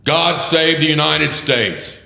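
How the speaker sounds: loud, high bailiff's proclamation; court crier call. Retold or imitated. Lawyer mimicking the court crier opening federal court, booming proclamation.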